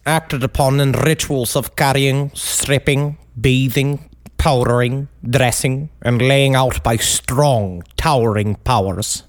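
put-on low, slow, droll British accent; British accent, rolled R's. Comic reading out a killer's own words, droll British accent. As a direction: intone it slowly in a deep British accent. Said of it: "the twist of the R's".

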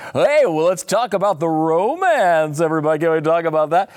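high, hammed-up game show host; Caesar Flickerman. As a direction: tee up a juicy topic like a host. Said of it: "he's like".

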